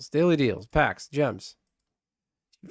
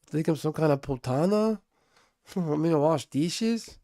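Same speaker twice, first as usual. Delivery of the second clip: Razor Ramon impression; 3 dB quieter than usual. Streamer mimics the wrestler's Scarface accent, lightly. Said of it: "he would talk like this, man".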